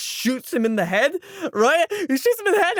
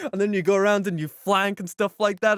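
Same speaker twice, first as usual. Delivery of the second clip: usual speaking voice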